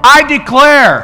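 high, loud declaration for the church to echo; preaching at full force. Real, not acted. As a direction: proclaim it boldly. Leads declaration.

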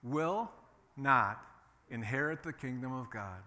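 not hit hard and loud; punched NOT. Shown as a demonstration. Preacher leading the congregation, loud NOT. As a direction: slam the word not. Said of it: "say this with me".

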